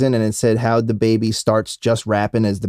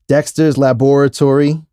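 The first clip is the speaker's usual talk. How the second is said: ordinary talk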